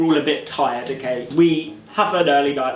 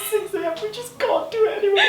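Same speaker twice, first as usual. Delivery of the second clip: very high, fast squeal through laughter; laughing helplessly; faster than usual. Real, not acted. Recalling the six A M race, helpless laughing. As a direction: wheeze the words out while cracking up.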